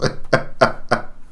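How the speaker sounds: soft airy chuckle; quiet trailing laugh; amused chuckle. Real, not acted. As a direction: chuckle quietly under your breath. Amused at the skeleton.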